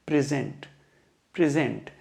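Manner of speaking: Present said pri-ZENT, weight on the second part; teacher's model pri-ZENT; stress on second syllable. Shown as a demonstration. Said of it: "second part पे पड़ रहा है".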